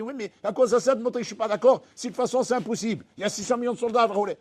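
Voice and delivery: mock objector. Listener objecting to the preacher, fast protest. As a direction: rattle off the objection. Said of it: "Tu dis".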